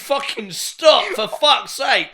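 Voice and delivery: loud, high, drawn-out mock outburst; shouted imitation of Dad losing it; dad flipping out. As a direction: imitate an exasperated dad yelling. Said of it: "flipped out".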